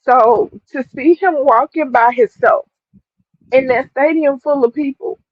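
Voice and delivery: tearful, voice breaking, pushing the words out; speaking through real tears; emotional, pitch lifting with tears; crying while talking. Real, not acted. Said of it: "I'm trying to push through".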